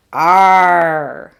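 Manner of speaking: loud, long pirate "Arr". Acted. Teacher voices Mister A R for learners, pirate "Arr". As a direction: hold out a hearty pirate growl-word. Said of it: "he says what every pirate says".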